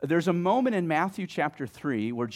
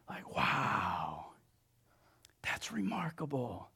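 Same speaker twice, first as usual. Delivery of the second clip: very soft, awestruck near-whisper; hushed awe; 9 dB quieter, much slower than usual. Acted. Preacher marvels at the voice from heaven, hushed awe. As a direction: let the wonder hush you. Awed reaction to God's words.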